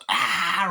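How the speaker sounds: high-pitched imitated scream; mock scream. Miss Tilly runs away, high mock scream. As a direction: cry out high, a retold fright.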